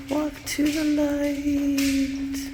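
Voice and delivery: slow, eerie ghost voice; drawn-out spooky call toward the light. Pretend. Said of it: "can't help but screw around".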